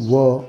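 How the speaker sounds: "व" as wuh, not double-you; phonic sound of W. Shown as a demonstration. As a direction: sound out "व" with a neutral vowel. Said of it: "डब्ल्यूचा उच्चार आहे".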